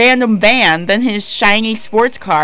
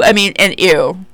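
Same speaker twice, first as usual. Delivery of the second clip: ordinary delivery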